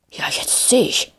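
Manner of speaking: slow, breathy stage whisper. A narrator's reading. Gimli spots the old man in the forest, whispering. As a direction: whisper it, drawing the words out. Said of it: "flüsterte Gimli".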